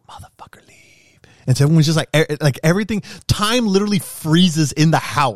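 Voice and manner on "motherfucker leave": whispered curse. Retold or imitated. Hiding from a knocker at the front door, whispered. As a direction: hiss it silently at the door. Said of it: "You're just like".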